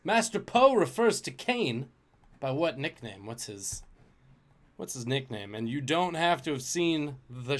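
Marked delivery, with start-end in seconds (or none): 0.0-3.2 higher, louder quiz question read; game show question delivery; quiz host read. Acted. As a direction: announce it like a trivia host.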